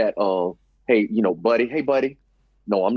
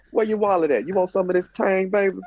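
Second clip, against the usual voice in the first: high, teasing come-on voice; hammed-up seductive woman; flirty hustler; 8 semitones higher than usual. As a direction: pitch it up and tease for the wallet. Acted flirt continues.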